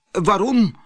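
high, alarmed question; frightened cry. Acted. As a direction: cry out in fear of the genie. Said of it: "riep hij". (bange uitroep)

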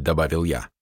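ordinary delivery, nothing marked

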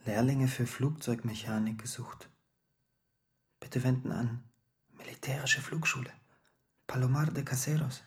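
soft, near-whispered reading of a notice; hushed reading aloud. Acted. Silvio reads the flying school notice, hushed. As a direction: read it out quietly, phrase by phrase.

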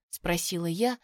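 plain reading voice

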